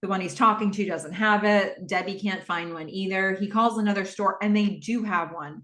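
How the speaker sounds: normal talking voice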